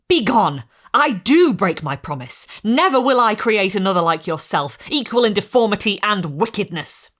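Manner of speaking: loud, firm defiance; angry refusal. Acted. Maker refusing his creature, loud and angry. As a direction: throw it back at him, furious.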